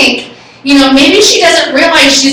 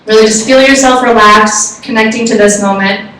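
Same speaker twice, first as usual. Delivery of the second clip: guided meditation; 4 semitones lower than usual. Acted. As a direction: gently guide the group back to the room. Leads out of a meditation.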